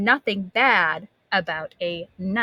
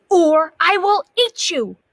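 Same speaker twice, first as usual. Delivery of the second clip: bold threat; 8 semitones higher, 6 dB louder than usual. Acted. Martha threatens the beans.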